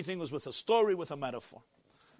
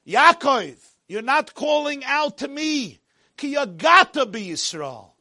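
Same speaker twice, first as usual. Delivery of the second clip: loud, raised call of rebuke; impassioned preaching; 7 semitones higher, 8 dB louder, slower than usual. Acted. God calling out to Yaakov, loud preaching. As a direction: call out as God to His people.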